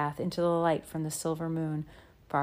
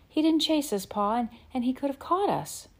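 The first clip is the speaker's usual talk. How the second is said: earnest; 8 semitones higher than usual. Acted. Girl pleading for the wolf.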